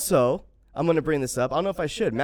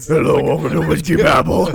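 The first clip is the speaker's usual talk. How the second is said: loud, joking demon greeting; 4 semitones higher, 11 dB louder than usual. Pretend. Host plays with an audio effect, loud mock demon voice. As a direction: boom out the welcome like a playful demon. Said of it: "Demon came into this".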